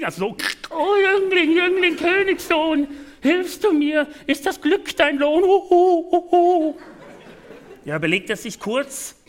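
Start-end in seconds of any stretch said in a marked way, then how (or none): 0.7-6.8 high croaking owl voice. Acted. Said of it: "sie krächzte".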